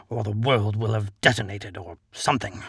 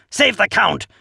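high, loud battle cry; roaring; 8 semitones higher, 5 dB louder than usual. A narrator's reading. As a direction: charge in roaring.

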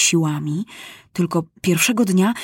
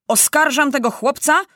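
raised, declaiming prosecutor; officer accusing in court; 8 semitones higher than usual. A narrator's reading. Officer accusing the boy before judges, declamatory. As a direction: announce the charge, pitched up. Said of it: "mówi oficer na sądzie".

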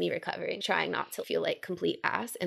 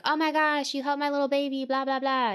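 high-pitched, gushing Southern boss; Southern belle gush, pitched up high; 7 semitones higher than usual. Retold or imitated. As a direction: imitate a glam Southern belle cooing over someone. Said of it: "this Southern, like, imagine, like, glam Barbie Southern belle".

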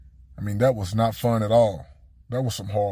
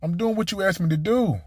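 higher, flustered self-defense, stumbling at the start; flustered defending himself; 8 semitones higher than usual. Retold or imitated. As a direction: play the confused player justifying himself to coach. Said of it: "I said".

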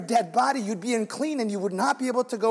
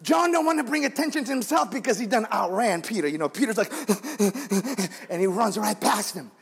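normal talking voice

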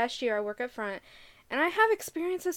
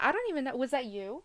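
ordinary talk

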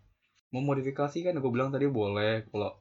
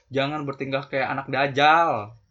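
much louder stern scold; 8 dB louder than usual. Real, not acted. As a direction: scold them hard. Scolding reckless kids. (omelan keras)

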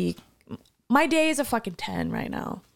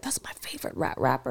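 whisper; 9 semitones lower, 5 dB quieter than usual. Retold or imitated. Coworker whispering excitedly.

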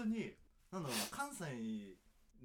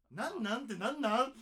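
loud, high-pitched incredulous outburst; indignant questioning; 11 semitones higher, 14 dB louder than usual. Real, not acted. Podcast host challenges a singer's phrase, indignant and loud. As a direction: fire the question back loudly, pitch jumping way up.

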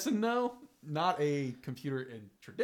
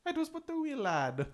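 high, slow mock Irish lilt; mock Irish accent; 12 semitones higher, slower than usual. Friend answers the accent joke with a high, slow mock Irish voice. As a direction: ham up a bad Irish accent.

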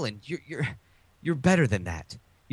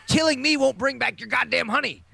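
mock manic movie line, pitched higher; Nicolas Cage impression; 10 semitones higher than usual. Quoting a T-shirt line, he does a hammed-up crazed Cage. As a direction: ham up a crazed action hero. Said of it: "Nicolas Cage screaming it".

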